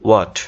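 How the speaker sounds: "what" with a rounded o, wot; correct pronunciation. Shown as a demonstration. Teacher offering the right option, correct pronunciation. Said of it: "সঠিক উচ্চারণটা হচ্ছে".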